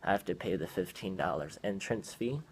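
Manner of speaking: ordinary delivery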